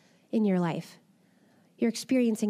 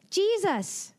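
joyful cry; 8 semitones higher than usual. Acted. Mary recognizes the risen Jesus.

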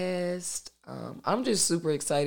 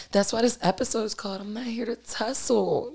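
ordinary delivery

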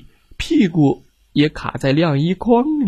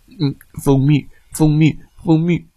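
greedy bear mumbling. Acted. Bear groping for honey.

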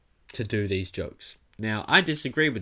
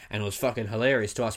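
ordinary delivery

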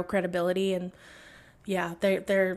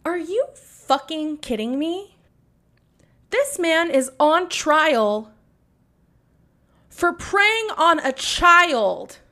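slow, loud, higher-pitched outrage; outraged rant; 5 semitones higher, 6 dB louder, slower than usual. Real, not acted. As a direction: spit out each word in disbelief. Ranting outrage at judge's leniency.

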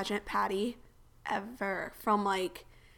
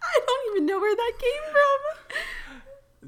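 high, slow, through helpless laughter; laughing; 4 dB louder, slower than usual. Real, not acted. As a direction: squeal it while cracking up. Cracking up at the hoot joke.

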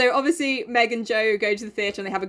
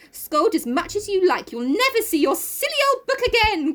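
high, taunting cry; hammed-up excited defiance; excited outcry; 11 semitones higher than usual. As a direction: crow it like a spiteful little sister. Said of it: "getting excited in her turn".

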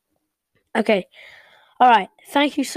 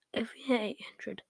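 hushed teasing whisper; 14 dB quieter than usual. Acted. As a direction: whisper it like a secret. Teasing a milestone promise.

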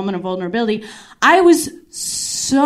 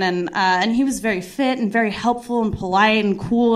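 ordinary delivery

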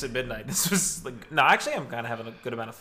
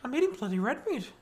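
much higher, put-on voice for the meat line; caricaturing a carnivore pundit, pitched way up; mock Jordan Peterson; 11 semitones higher, 4 dB quieter than usual. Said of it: "he just eats meat apparently".

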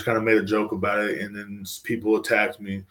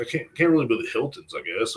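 usual speaking voice

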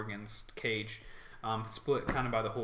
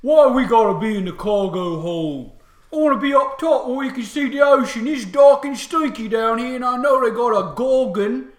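loud, high-pitched orc complaint; upset orc; 9 semitones higher, 14 dB louder than usual. Acted. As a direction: gripe loudly as a fed-up orc soldier. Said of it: "they're very upset".

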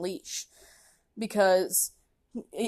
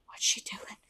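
much softer whispered worry; scared whisper; 10 dB quieter than usual. Retold or imitated. Watching the lady, scared whisper. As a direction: whisper it nervously. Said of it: "and I'm like".

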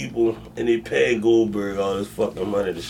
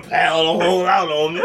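ordinary talk, nothing marked

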